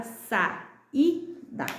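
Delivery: "saída" split sa - í - da; three slow beats with the í alone; syllable by syllable. Shown as a demonstration. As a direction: separate the vowels of "saída". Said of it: "ficam em sílabas diferentes".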